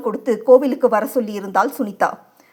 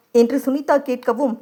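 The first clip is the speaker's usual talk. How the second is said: plain reading voice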